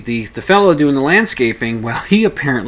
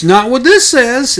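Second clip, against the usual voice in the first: high, loud cocky comeback; smug retort; 12 semitones higher, 8 dB louder than usual. Retold or imitated. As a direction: toss it over the shoulder while walking off. Landscaper's smug parting retort.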